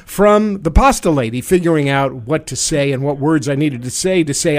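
usual speaking voice

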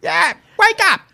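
high mock British; British accent. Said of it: "weird British accent".

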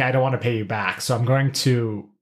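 usual speaking voice

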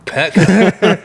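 laughing. Real, not acted. Laughing repeat of a joke.

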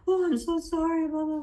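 mock his hollow apology. Imitating the boyfriend's fake apology, sing-song. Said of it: "he's angry at me or".

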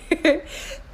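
short, high burst of laughter; real laugh. Real, not acted. Host amused by her own odd phrasing, laughing. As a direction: break into a quick laugh.

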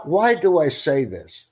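usual speaking voice

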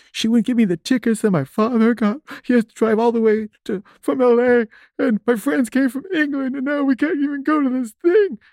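very high wailing, acted out; hammed-up crying, words stretched into a squeal; sobbing. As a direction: imitate bawling a complaint through sobs. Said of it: "and I'm sobbing".

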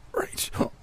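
very soft, whispery croak. A narrator's reading. Gasping roommate calling her friend, croaked. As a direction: force the name out with no breath. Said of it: "she croaked".

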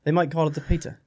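a light, badly faked Swiss accent; mock Swiss accent, lightly put on; horrible Swiss accent. Host joking about Swiss listeners, badly faked Swiss accent. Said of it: "That's a horrible Swiss, Swiss accent".

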